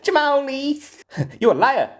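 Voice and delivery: high, squeaky comic accusation; hammed-up high-pitched impression; Chris Tucker. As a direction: squeal it in mock outrage. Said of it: "It's Chris Tucker".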